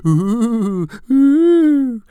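slow, loud, high ghostly ooh; teasing imitation of a ghost moaning; mock ghost moan.

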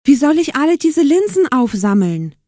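high, loud, helpless complaint; dismayed wail. A narrator's reading. Aschenputtel facing all the lentils, wailing in dismay. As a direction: wail at an impossible task.

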